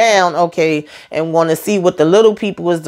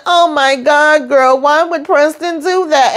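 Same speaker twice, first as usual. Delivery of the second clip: put-on shocked girlfriend; 9 semitones higher, slower than usual. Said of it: "And Sabrina".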